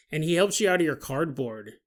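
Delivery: ordinary talk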